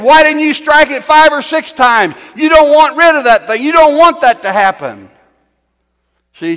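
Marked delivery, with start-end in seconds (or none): 0.0-5.1 loud, high scolding shout; angry prophet. Retold or imitated. Elijah scolding the king, shouting angrily. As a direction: rebuke him at full voice. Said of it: "Got mad at him and said".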